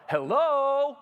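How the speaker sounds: loud high call into the house. Retold or imitated. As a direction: call out hello into the open house. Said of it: "and I yell".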